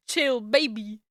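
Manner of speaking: ordinary delivery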